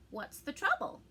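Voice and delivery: a hint of gravel, calling from below; gravelly voice. A narrator's reading. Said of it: "a gravely voice called out".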